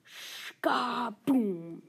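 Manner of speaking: loud mouthed explosion boom; explosion noise. Acted. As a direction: belt out a blast sound. Explosion sound effect.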